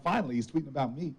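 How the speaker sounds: said through a laugh. Retold or imitated. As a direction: chuckle through the line. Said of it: "I'm like".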